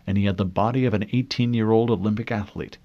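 usual speaking voice

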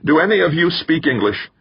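high-pitched calling out. A narrator's reading. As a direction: project it over a crowd. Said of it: "Danvers raised his voice".

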